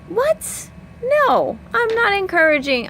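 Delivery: a shocked mom shooting it down, pitch way up; high-pitched no-way reaction to a silly question; incredulous what. Retold or imitated. Mom rejecting the what-ifs.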